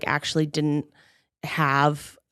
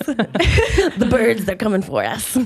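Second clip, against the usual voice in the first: laughing paranoid conspiracy whisper-warning; a touch of doomsaying conspiracy nut; conspiracy theorist voice; 5 semitones higher, 3 dB louder, slower than usual. Pretend. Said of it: "It's my conspiracy theorist voice".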